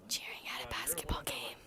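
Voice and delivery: soft ASMR whisper. Pretend. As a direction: whisper it into the mic. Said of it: "It's an A S M R".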